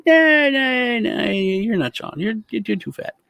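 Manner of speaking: high, slow, flat-out refusal; imitating dismissive grandpa. Grandpa refuses to believe it is his grandson, high slow voice. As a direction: wave him off like a stubborn old man. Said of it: "It's like".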